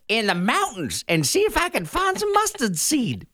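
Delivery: a gold panner fretting over his find; old prospector. Pretend. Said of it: "Old prospector's gonna have to".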